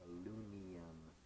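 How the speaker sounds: "alunium", dropping the middle syllable; mispronounced aluminum. Retold or imitated. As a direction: say "alunium" as a-LOON-ee-um, losing the M. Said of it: "he used to call aluminum alunium".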